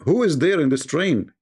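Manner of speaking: normal talking voice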